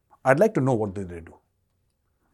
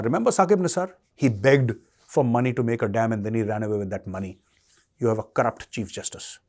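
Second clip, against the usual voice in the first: usual speaking voice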